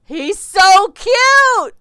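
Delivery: very high, loud, slow squeal of delight; gushing squeal. Real, not acted. Player gushing over shy Victor, high delighted squeal. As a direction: squeal it like something adorable.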